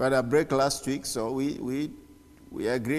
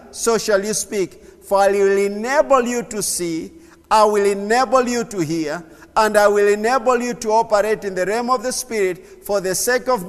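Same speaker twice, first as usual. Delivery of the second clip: loud, raised prophetic preaching; preaching at full force; 6 semitones higher, 7 dB louder than usual. Acted. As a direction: proclaim it like a prophet over the church.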